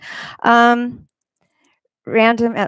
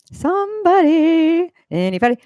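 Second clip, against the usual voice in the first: calling out; 9 semitones higher than usual. Real, not acted. Streamer asking silent chat for an answer, calling out. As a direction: sing it out long and high, fishing for an answer.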